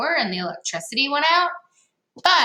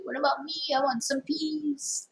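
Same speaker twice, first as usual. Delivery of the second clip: a light, higher pleading dog voice; dog's voice; 5 semitones higher, 6 dB quieter than usual. Pretend. Teacher voicing the dog in the picture, pleading for peas. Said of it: "He's like".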